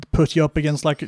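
usual speaking voice

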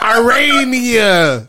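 laughing. Real, not acted. Drawn out laughing.

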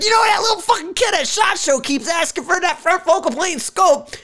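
hammed-up exasperated yell about the kid; exasperated company guy. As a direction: blurt it high like a fed-up exec caving. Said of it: "And they're like".

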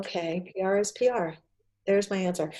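soft, small voice of resigned acceptance; meek inner thought. Retold or imitated. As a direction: quietly take it in, as if thinking to yourself. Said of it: "And I thought".

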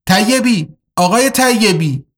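high, urgent shout toward the driver's cabin. Acted. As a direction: call out sharply for the driver, alarmed. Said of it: "داد زد". (فریاد بلند و هراسان)

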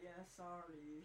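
slow, soft, low brush-off; mock apology. Cinema worker turning down a refund, mock apology. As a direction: drag out an unhelpful sorry. Acts staff refusing the refund.

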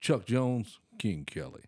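slow announcement. Retold or imitated. Speaker imitates dad announcing himself, slow and deliberate. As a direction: imitate a man slowly announcing each name, with a pause between. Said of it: "it would be slow like that".

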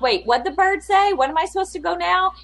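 high, puzzled questions; lost tourist who missed the clue; confused rider. Retold or imitated. Imitating riders who missed the bird's clue, high and confused. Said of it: "you're like".

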